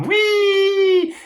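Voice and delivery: high, loud playground squeal; mock merry-go-round whoop; gleeful wee. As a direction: squeal like a kid on a ride. Mimics riding the karma wheel.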